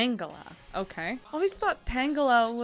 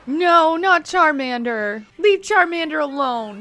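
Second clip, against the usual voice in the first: protesting cry; 8 semitones higher, 10 dB louder than usual. Real, not acted. Viewer pleading for Charmander to be left alone, loud protest.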